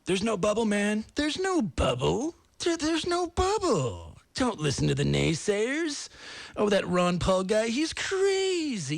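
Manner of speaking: high, slow mocking voice of real estate insiders; high drawn-out mock voice of a dismissive realtor; mocking industry insiders. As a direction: lampoon the cheerleaders who swore there was no bubble. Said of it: "they're saying".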